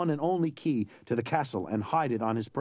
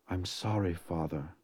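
low, soft, defeated murmur; sorrowful apology; 6 semitones lower, 5 dB quieter, slower than usual. A narrator's reading. Wounded Inigo apologises to his dead father, sorrowful. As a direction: let it sink lower and softer, full of regret.

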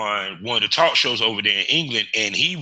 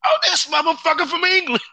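much higher, louder amazed exclaim; astonished; 4 dB louder than usual. Retold or imitated. After Googling the actor, high astonished exclaim. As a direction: blurt it out high in disbelief. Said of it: "I said".

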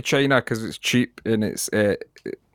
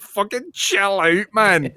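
slow, much higher, fed up; exasperated plea; 8 semitones higher, much slower than usual. Retold or imitated. Telling the shouting English tourist to calm down, slow and exasperated. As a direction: drag it out to calm him down. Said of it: "And I'm like".

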